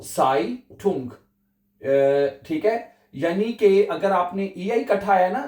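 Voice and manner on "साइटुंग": साइटुंग said slowly, ai like eye, ending -tung; German pronunciation. Shown as a demonstration. Teacher to students, slow clear model word. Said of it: "ये देखिएगा".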